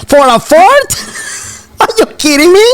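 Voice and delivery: high-pitched mock incredulous question; retold disbelief. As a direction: act out amused disbelief, pitch jumping up. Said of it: "I was like".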